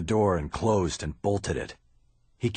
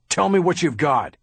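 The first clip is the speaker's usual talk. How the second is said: loud, higher angry demand; 8 semitones higher, 6 dB louder than usual. Acted. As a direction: order it furiously. Bosch demands, furious.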